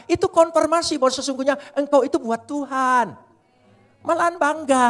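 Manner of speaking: high, loud fiery sermon voice; proclaiming loudly and high to the congregation; full-force preaching. Acted. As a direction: drive the point home like a revival preacher.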